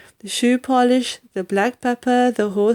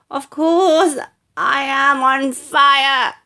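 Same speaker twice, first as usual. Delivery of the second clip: higher, louder and slow, an indignant yell; 6 semitones higher, 4 dB louder, slower than usual. Acted. Grandma to George, indignant that he doubts her: slow angry yell. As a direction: snap back in outrage. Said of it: "she yelled".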